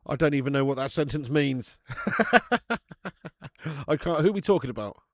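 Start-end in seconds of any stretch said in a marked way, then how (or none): 1.8-3.8 long, high burst of laughter; real laughing fit. Real, not acted. Streamer baffled by a chat message, real high laughing fit. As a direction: crack up helplessly.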